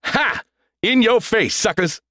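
high-pitched crowing; gleeful gloating. A narrator's reading. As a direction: crow over the losers. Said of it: "Thor shouted gleefully".

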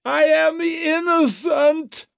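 very high, drawn-out cry of protest; high-pitched declamatory shriek; shrieking in ringing tones. A narrator's reading. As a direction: shriek it slowly, ringing through a courtroom. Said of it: "shrieked Wetterland in ringing tones".

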